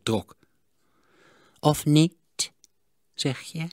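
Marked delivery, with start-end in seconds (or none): 1.6-3.7 1 hopeful miser. A narrator's reading. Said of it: "stille hoop".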